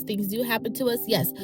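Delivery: ordinary talk, nothing marked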